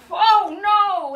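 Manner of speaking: high, loud storybook dismay; big hammed-up alarm for the children; mock alarm. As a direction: cry out as the house flies apart. Straw house is blown down.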